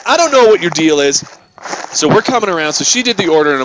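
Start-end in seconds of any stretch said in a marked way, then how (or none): 0.0-1.2 retold confrontation. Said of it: "And so I'm like".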